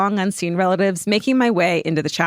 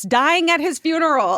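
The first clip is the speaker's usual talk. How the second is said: DYING AT HIS FUNERAL pitched way up, word by word in capitals; high, big emphasis on all of "dying at his funeral"; all-caps emphasis; 8 semitones higher than usual. A narrator's reading. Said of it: "all caps".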